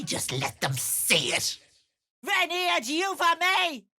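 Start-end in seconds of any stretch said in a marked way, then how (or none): 2.2-3.9 high, cackling Latin spell; cackling incantation. Acted. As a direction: cast it with a wicked laugh. Spell cast with laugh.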